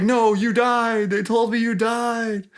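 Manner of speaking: high, hammed-up villain denial; movie villain. Villain sees the dead dad return, mock horrified disbelief. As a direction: wail it like a melodramatic bad guy in shock. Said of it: "the main villain at the beginning of the movie".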